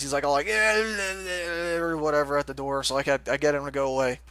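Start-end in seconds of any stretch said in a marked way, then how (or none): 0.5-1.8 put-on drawn-out, higher drunken slur; drunk friend. Drunk roommate's friend at the door, slurred mock drunken noise. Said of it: "my drunk roommate's one of his friends".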